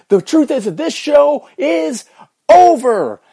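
courtroom shout. Pretend. Host parodies a courtroom speech to end the show, shouting. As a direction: bellow it like a movie lawyer. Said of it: "go full on lawyer mode".

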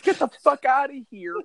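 a hammed-up mock scream at kids; mock Santa yell.

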